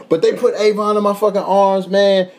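normal talking voice

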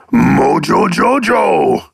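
slow, drawn-out cartoon villain voice; Mojo Jojo. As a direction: stretch the name out, rising high then falling. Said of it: "I watch Powerpuff Girls".

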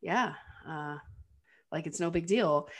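unbothered. Retold or imitated. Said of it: "Cliff is just kinda looking like".

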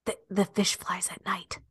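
hushed spy whisper. Pretend. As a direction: whisper it like a secret code. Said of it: "I'd be like".

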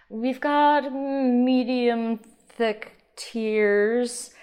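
very slow, drawn-out snob voice; rich snob. Acted. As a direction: savor each word like a wine snob. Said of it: "I don't need to stop with the snob voice".

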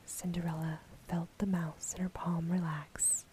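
usual speaking voice

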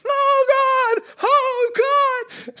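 very high screaming No, no; mock scream. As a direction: scream while being dragged away. Greg dragged, screaming.

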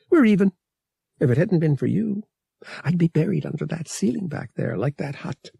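quick, earnest reply to Han; lightly voiced young woman, fast; Bria's voice. A narrator's reading. Bria's voice in story.

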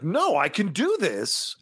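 insistent actor's protest. Retold or imitated. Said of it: "I almost feel like he was trying to be like".

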